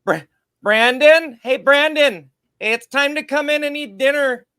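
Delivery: high, loud Minnesota mom calling from the porch; Minnesota accent calling. Pretend. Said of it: "That Minnesota accent".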